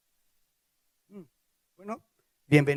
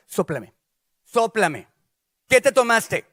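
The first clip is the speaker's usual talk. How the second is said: stern interrogation, growing louder; suspicious mother; 7 dB louder, slower than usual. Acted. Mother suspects son drank, building stern demand. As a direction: demand a breath test.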